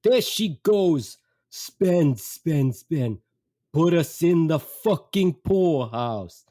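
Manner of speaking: loud, slow, put-on grumbling dad; exasperated dad. Dad to son about mother's spending, exasperated. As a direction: imitate a father griping about money, drawn out. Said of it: "My dad looks at me and says".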